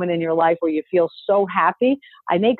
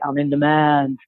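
deliberately deep-voiced affirmation; lowered voice; 4 semitones lower than usual. Shown as a demonstration. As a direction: drop into a low, self-assured register. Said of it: "I lower my voice when I say it".

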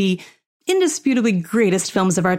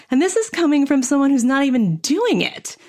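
ordinary delivery

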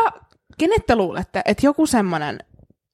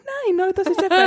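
high, quick retold gushing reply; imitated gushing; 9 semitones higher, faster than usual. Answering a friend about cute children, imitated excited gushing. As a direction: act out cooing over something cute.

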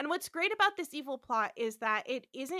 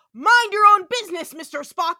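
much louder, higher angry outburst; sudden snap; 6 semitones higher, 16 dB louder than usual. Retold or imitated. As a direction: bite his head off without warning. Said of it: "suddenly turns and goes".

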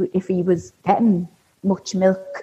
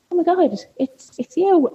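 surprised recognition; 9 semitones higher than usual. Retold or imitated. Recognising the midwife in retelling.

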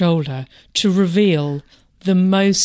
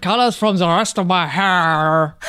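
fake French accent. Mocking chaperone Claude to Lynn, hammy French accent. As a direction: ham up a bad Frenchman. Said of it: "Claude, the fake Frenchman".

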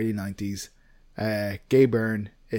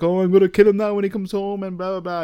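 mock threat; 8 semitones higher, 6 dB louder than usual. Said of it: "And she's like".

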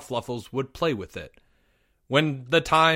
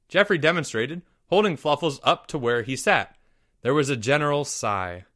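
usual speaking voice

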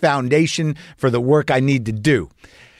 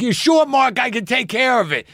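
eager stranger; 8 semitones higher than usual. Retold or imitated. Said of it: "And he's like".

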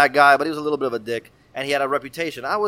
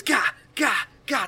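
high growling grunts; angry grunts; 9 semitones higher, slower than usual. Retold or imitated. Teacher plowing through students, high angry grunts. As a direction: grunt while shoving kids aside. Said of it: "He starts fucking throwing kids".

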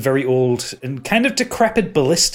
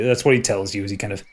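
ordinary talk

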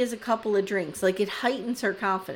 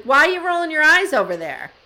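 higher, louder mock call-out; confrontational; 6 semitones higher, 7 dB louder than usual. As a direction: demand it accusingly, imitating her. Retelling a confrontation on camera.

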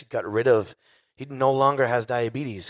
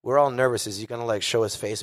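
ordinary talk, nothing marked

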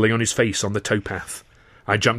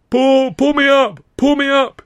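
very high, loud, slow panicked scream; shrieking plea, dragged out in terror; terrified cry; 9 dB louder, slower than usual. Acted. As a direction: beg for your life over the water. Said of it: "he cried".

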